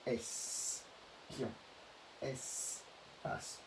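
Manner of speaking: slow "espion", "espace", split after the è-s syllable; syllable demo. Shown as a demonstration. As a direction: sound out es... pion, es... pace quietly. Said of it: "On dira".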